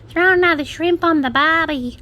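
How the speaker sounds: high, hammed-up mock Australian accent; bad fake Aussie accent. Mocking people who try Aussie slang, bad fake Aussie accent. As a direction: imitate outsiders failing at an Aussie accent. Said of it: "people try and do the Aussie accent".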